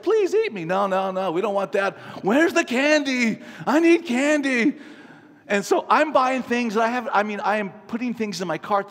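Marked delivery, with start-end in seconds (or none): 0.0-0.6 high pleading vegetable voice; pleading asparagus. Retold or imitated. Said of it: "asparagus is like". 2.2-4.8 slow, high mock whine; whiny candy craving. Shoppers' greedy demand, mocked.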